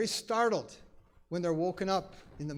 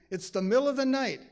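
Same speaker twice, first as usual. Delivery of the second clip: ordinary talk, nothing marked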